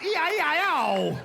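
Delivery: high, loud, sing-song yelp; hammed-up pained sing-song cry; mock scream. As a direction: yelp the nursery tune like a bitten man. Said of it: "The man screamed out".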